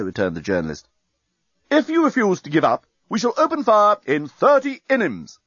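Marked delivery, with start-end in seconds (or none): 1.7-5.4 high, formal threat; enemy fleet voice. A narrator's reading. Enemy fleet threatening to open fire, commanding. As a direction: announce the deadline like an officer giving an ultimatum. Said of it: "said the voice from the enemy space fleet".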